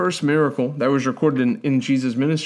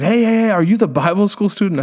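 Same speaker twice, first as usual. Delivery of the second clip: higher, excited calling-out question; imitated eager greeting; 6 semitones higher than usual. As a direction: hail someone eagerly, voicing another man. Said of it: "And he said".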